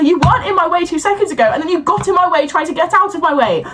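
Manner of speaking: high, fed-up scolding; high-pitched exasperated scolding; exasperated rant. Real, not acted. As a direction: rant at the clueless stranger.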